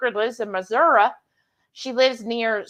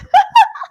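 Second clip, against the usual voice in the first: laughing; 11 dB louder than usual. Real, not acted. Laughs after reading chat.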